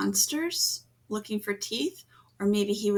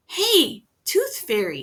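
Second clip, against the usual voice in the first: calling out; 5 semitones higher, 6 dB louder than usual. A narrator's reading. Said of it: "he would call to her".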